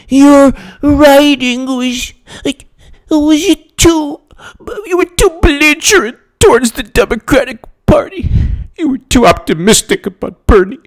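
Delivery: mocking nasal critic voice, high and dragged out. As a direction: mimic the critics high and whiny.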